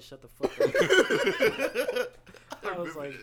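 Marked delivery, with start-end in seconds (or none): 0.0-0.7 loud sudden snap. Retold or imitated. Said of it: "He turned around and said". 0.8-2.6 high loud cackle; loud cackle at the clip; loud laugh. Real, not acted.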